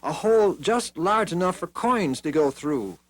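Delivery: put-on a young king proposing a plan; boy king Joash. A narrator's reading. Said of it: "said Joash".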